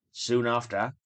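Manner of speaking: plain reading voice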